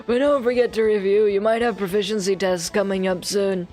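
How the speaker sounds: lightly voiced old teacher; old man voice. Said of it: "he's still getting the old man voice".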